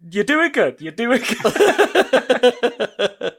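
mock encouragement. Retelling Felipe encouraging a first-time shooter, laughing mock encouragement. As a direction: cheer him on, cracking up at the end.

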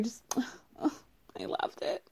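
hushed, moved sigh into the words; sigh, then let it out softly, touched; emotional, near tears. Real, not acted. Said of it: "hate to squeeze out a thug tear over this".

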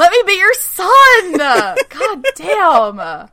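high, loud, drawn-out demand; mock desperate shout. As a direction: escalate the begging into a hammed-up yell. Same plea escalated to an outburst.

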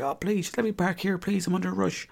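pleading with the car park man, high and soft; mock pleading. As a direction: whimper a mock plea to be let park. Begging the parking attendant.

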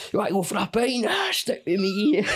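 hammed-up high voice urging him along; mock pub pal. Pal coaxing someone out for a pint, mock chummy.

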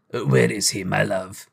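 a touch of gentle endearment; kindly Scrooge. A narrator's reading. Scrooge questioning the maid, gently. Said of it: "said Scrooge".

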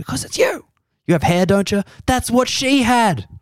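high hammed-up scaremongering voice; whispering tabloid subtext. Comedian voicing the Daily Mail's sneaky subtext, high mock menace. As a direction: hiss the hidden threat of the headline. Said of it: "the breath is saying".

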